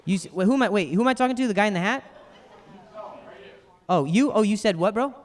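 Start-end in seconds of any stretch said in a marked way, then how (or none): none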